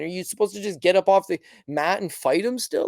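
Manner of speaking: ordinary talk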